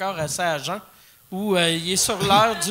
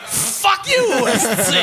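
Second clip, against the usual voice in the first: much higher and louder, laughing; laughing mock insult; 6 semitones higher, 9 dB louder, much slower than usual. Real, not acted. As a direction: yell it at your buddy, cracking up. (insulte pour rire, beaucoup plus aigu et fort)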